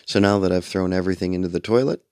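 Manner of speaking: ordinary delivery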